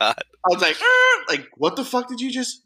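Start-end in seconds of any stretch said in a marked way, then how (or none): 0.8-1.2 high, startled "er" of disbelief; high shocked squeak; stunned disbelief. Retold or imitated. Shocked at coworker's remark. 1.6-2.6 much higher, shocked disbelief; a high, baffled what-did-you-just; retelling his shock at a coworker, high incredulous. Retold or imitated. Retold outrage at coworker.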